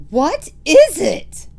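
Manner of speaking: high, slow, loud witch cry. Acted. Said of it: "the others cried".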